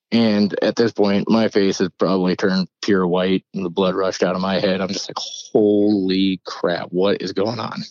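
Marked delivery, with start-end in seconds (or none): 5.2-7.8 drawn-out, slow disbelief on "holy"; retold stunned shock. Storyteller reliving flying dishes, stunned and drawn-out. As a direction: stretch it out, dumbfounded.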